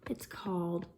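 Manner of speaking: hushed whisper before the title. Acted. Teacher whispering the book title to the kids. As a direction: breathe it like a secret.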